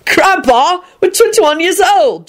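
high, slow shout back; a high drawn-out retort. Acted. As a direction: yell it back at grandpa. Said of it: "Jamie shouted back".

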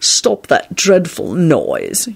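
very slow, bored drawl; yawning Snape. A narrator's reading. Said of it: "yawned Snape".

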